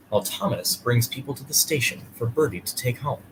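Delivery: ordinary delivery, nothing marked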